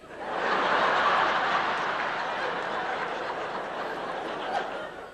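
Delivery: audience bursts out laughing; big laugh from the crowd; crowd laughter. Real, not acted.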